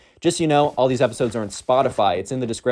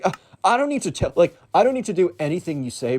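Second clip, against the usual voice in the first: put-on raised pitch, snotty teen voice; mock defiant emo kid; 6 semitones higher than usual. Emo kid sneering back at Hank, snotty and high. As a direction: play a defiant teen mocking the rules.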